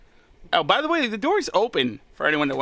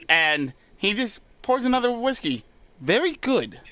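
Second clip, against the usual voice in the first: ordinary talk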